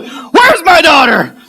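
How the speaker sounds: high, slow full-volume demand; drawn-out acted yell in his face; furious dad shout. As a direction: bellow it like an enraged father. Angry dad confronts him.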